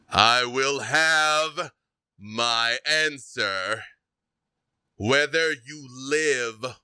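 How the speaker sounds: slow, heavy, threatening demand; menacing villain. Acted. Game master voicing the villain demanding the Raven Crown, slow and menacing. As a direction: loom over them and drag out every word.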